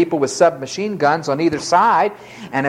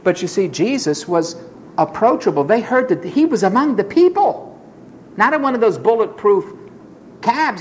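normal talking voice

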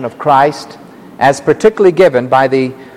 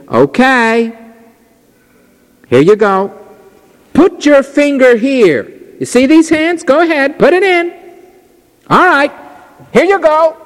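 gently voiced Jesus, higher, slowed; higher inviting voice offering his hands; Jesus speaking; 9 semitones higher than usual. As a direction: coax Thomas to touch the wounds. Preacher voicing Jesus to Thomas.

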